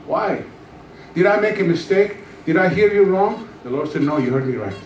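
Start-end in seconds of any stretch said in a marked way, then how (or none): none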